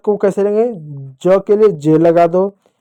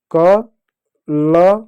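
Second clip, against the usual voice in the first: syllable by syllable; much slower than usual. Shown as a demonstration. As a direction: draw out कलम very slowly, a pause after each sound. Spelling out the corrected word.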